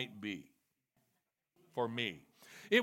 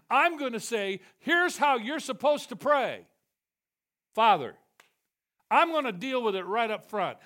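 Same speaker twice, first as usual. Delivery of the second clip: loud, high preaching at full force; fiery preaching; 7 semitones higher, 8 dB louder than usual. Acted. Preacher to congregation, voicing God in a loud ringing sermon.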